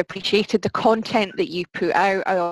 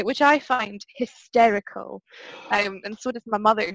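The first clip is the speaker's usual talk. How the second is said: ordinary delivery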